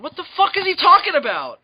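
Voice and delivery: loud, high-pitched bafflement; exasperated outburst. Acted. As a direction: burst out in sheer disbelief. Exasperated rant about the singer.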